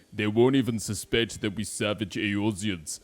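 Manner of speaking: forceful put-on accent; accented game character.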